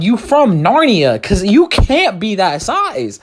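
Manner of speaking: loud, high, slow teasing; mock disbelief. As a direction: draw out the jab in disbelief. Mocking big teen.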